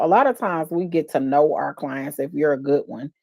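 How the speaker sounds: ordinary talk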